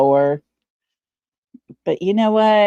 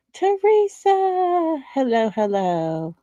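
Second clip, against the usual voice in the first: high, slow sing-song hello; singsong greeting; 6 semitones higher than usual. Real, not acted. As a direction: sing the name out, then settle.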